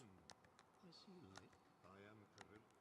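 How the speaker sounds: faint, distant spirit voice; hushed otherworldly whisper; ghostly whisper. Acted. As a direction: murmur like a fading ghost. Repeating the ghost's lines.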